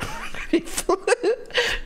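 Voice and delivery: ringan; tertawa tinggi tanpa suara. Real, not acted. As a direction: tawa lepas yang tinggi. Laughs at chat's bad advice.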